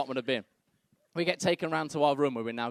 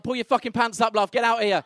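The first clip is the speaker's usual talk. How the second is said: loud, raised-pitch mock order; angry partner; 6 semitones higher, 7 dB louder than usual. Partner ordering his love out, loud and indignant. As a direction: act out an outraged command, loud and high. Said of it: "I'm like".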